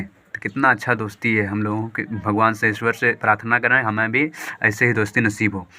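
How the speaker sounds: ordinary delivery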